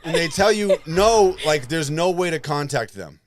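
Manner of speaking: loud, high, worked-up complaining; heated rant. Retold or imitated. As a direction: rant about the company at full volume. Heated rant about the ticket company.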